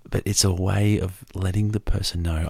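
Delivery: usual speaking voice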